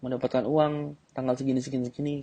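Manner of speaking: girl boasting she'll buy a car, lightly voiced; mock confident girl. Shown as a demonstration. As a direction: boast your plans sure of yourself.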